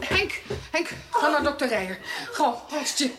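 hurried, high call to the servant; urgent orders. Acted. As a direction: send him running. Sends servant for doctor. (dringend, gehaast bevelend)

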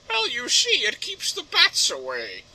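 very high, drawn-out put-on voice; amused high-pitched villain explanation; villain parody. Villain explains mushrooms on the manor, high voice. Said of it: "So, why are you doing that?".

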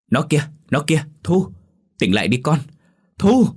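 high, loud desperate cries; panicked scream. Acted. Father spots his missing daughter by the river, panicked scream. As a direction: cry out in alarm to someone far off. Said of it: "hét lên thất thanh".

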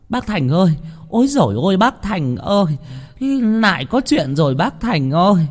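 high, frantic calling out; panicked villager. Acted. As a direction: cry for the village chief in alarm. (dân làng gọi thất thanh, giọng cao)

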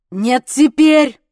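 loud, drawn-out bark; slow, forceful shout; barked order. A narrator's reading. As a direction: bark it, refusing any delay. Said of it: "рявкнул он".

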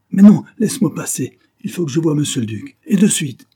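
loud, high and insistent; agitated servant raising his voice; frantic demand. A narrator's reading. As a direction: push your way through, urgently. Frantic majordomo demands to pass.

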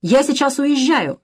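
high, agitated announcement; hysterical cry. A narrator's reading. Sonya threatening to leave after the insult, mildly hysterical outburst. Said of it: "истерично выкрикнула Соня".

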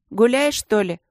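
умеренно сыгранный оклик, тон повыше; окликни уходящую девчонку; крик вдогонку. A narrator's reading. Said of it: "крикнул он ей вдогонку". (чуть приподнятый мальчишеский оклик)

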